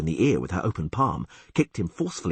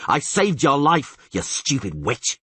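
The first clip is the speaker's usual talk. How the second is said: slow, loud furious outburst; angry shout; 5 semitones higher, 4 dB louder, slower than usual. A narrator's reading. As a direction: hurl it at her.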